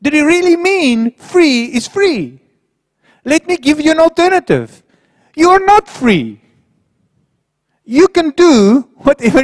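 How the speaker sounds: high, mocking tempter's voice; sly devil. Preacher mimics the devil tempting believers, high mocking voice. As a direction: coax them like a sneering devil. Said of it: "See, the devil is very sly. He says".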